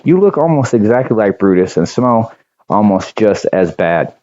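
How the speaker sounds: ordinary delivery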